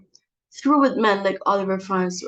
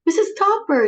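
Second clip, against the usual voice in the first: big high, loud gush on the name; effusive hostess voice on the phone; gushing greeting; 12 semitones higher, 6 dB louder than usual. Acted. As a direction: sing out the name with put-on delight.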